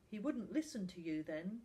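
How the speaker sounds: plain reading voice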